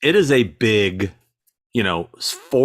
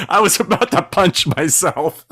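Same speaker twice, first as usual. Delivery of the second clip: loud, higher, telling it through laughter; laughing; 8 semitones higher, 3 dB louder than usual. Real, not acted. Storyteller recalls nearly punching his own reflection, laughing. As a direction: crack up at your own story.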